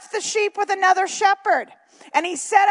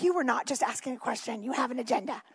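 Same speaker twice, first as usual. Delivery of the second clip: much softer and lower, a quiet accusing retort; hushed retort; 7 semitones lower, 11 dB quieter, faster than usual. Retold or imitated. Eliab quietly accusing David of an agenda, hushed. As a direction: mutter it like a knowing older brother. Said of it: "It's like".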